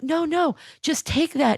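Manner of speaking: high-pitched imitation of coaxing; retold coaxing. The house coaxing its victim.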